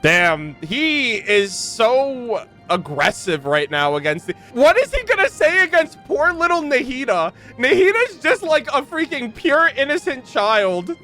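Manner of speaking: normal talking voice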